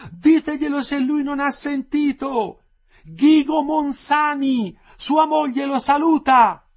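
high, drawn-out loud call; woman shouting out. A narrator's reading. Wife asking prisoners to pass her greeting on, shouting. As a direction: holler it slowly towards the cells. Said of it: "gridò la stessa voce".

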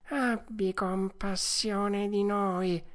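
slow, high pleading wail; whining fox. A narrator's reading. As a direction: beg for pity, dragging every word. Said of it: "con voce di piagnisteo". (piagnisteo lento e supplichevole)

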